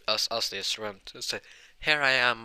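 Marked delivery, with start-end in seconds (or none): 1.8-2.4 calling out. Retold or imitated. Calling out to mom.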